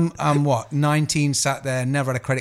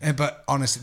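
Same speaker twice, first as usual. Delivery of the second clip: normal talking voice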